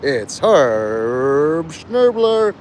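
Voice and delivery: slow, drawn-out ring announcer; Michael Buffer announcer. Pretend. Announcing a wrestler to the stage, slow hammed-up announcer. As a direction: stretch the name like a fight introduction. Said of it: "I feel like, uh, Michael Buffer".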